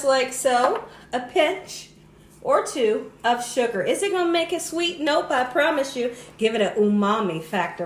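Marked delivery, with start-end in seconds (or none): none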